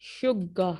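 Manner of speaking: "शुगा" said with a hard g and no d; shug sound. Shown as a demonstration. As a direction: model "शुगा" crisply. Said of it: "शुग कुछ इस तरह से होगा".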